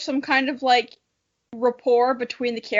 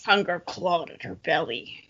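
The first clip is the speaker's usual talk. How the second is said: low, lightly hammed-up narrator voice; mild mock-ominous reading; mock dramatic; 6 semitones lower, 5 dB quieter than usual. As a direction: read it like a cheesy novel, a touch grave. Mock-dramatic reread of phrase.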